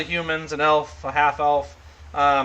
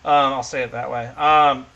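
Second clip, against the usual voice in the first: ordinary talk, nothing marked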